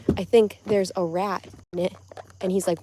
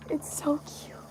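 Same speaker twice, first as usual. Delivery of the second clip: whispering; 7 semitones higher, 6 dB quieter than usual. Real, not acted. Whispering near the pet.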